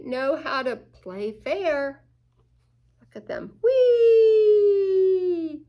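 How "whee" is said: high, loud whee of fun; gleeful whee. Acted. Otter shouting while it slides, gleeful. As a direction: squeal with sliding joy.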